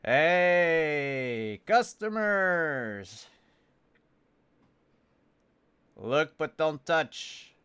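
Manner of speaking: booming, slow gruff shopkeeper call; loud shopkeeper greeting. Acted. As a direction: bellow it at the customers. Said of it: "perks up when you come in and says".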